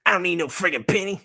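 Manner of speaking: high, fast refusal; indignant puppet. Pretend. Puppet turning down its maker's costume idea, indignant. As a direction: refuse hotly as a puppet character.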